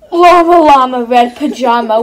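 loud hammed-up wail. Narrating baby llama wailing, loud hammed-up cry. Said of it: "wailing for his mama".

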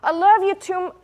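high, loud, anxious protest; pleading disciple. Retold or imitated. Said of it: "the disciple getting a little bit testy and saying".

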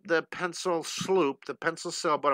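usual speaking voice

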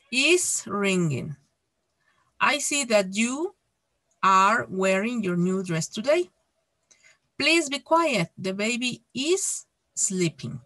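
slow, emphatic English model sentences with pauses; example read-out. Shown as a demonstration. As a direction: read each phrase out deliberately for learners.

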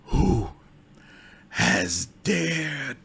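rasping, irritated undead priestess; raspy vexed undead. Acted. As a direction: rasp it out, annoyed at the intrusion. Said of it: "a rasp of vexation".

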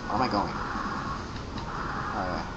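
normal talking voice

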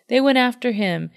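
ordinary delivery

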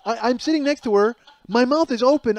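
high, loud comic astonishment; slack-jawed disbelief. Retold or imitated. Husband retelling his wife's blunder, stunned. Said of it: "And I'm slack-jawed".

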